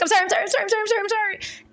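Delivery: put-on high rushed child's voice, pleading sorry over and over; panicked child apology. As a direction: daughter voiced apologizing frantically to mom over spilled sauce.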